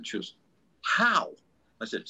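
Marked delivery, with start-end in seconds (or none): 0.8-1.3 1 wife asking. Retold or imitated. Wife asking about a retake.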